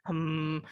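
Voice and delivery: soft, low closed-lip hum; gentle humming. Shown as a demonstration. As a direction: hum softly to warm up the voice. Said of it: "humming aja". (senandung humming pelan)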